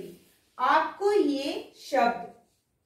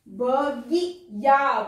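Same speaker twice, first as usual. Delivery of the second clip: drawn out slowly, each sound apart; slow syllable-by-syllable reading; 4 dB louder, much slower than usual. Shown as a demonstration. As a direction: sound out बगिया slowly for the children. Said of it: "teacher sounds out word".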